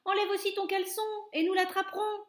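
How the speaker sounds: high, loud, fast teasing call; jeering shout. A narrator's reading. Cook teasing the panicked man, fast high loud call. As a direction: holler it, half laughing at him. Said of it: "cria quelqu'un".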